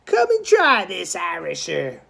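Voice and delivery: high, loud jeering dare; a boy taunting the Dead Rabbit to fight. Acted. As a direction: throw the dare in his face. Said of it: "was Charlie's taunt".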